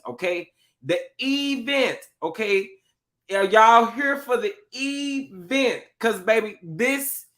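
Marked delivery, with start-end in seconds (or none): 0.8-2.0 "event" with a long, high, drawn-out E; stretched first E. Shown as a demonstration. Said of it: "put a couple E's in there". 4.7-5.8 "event" slowly, with a long high E; milk the E in "event", then hit vent; stretched first E. Shown as a demonstration. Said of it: "put a couple E's in there".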